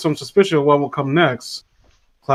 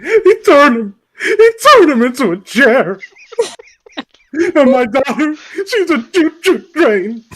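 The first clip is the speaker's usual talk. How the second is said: high, loud, hammed-up sobbing lament; mock wailing; 10 semitones higher, 4 dB louder than usual. Tree mourning kin carved into toys, mock tearful wail. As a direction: wail in fake horror through laughter. Horrified tree tells of relatives' fate.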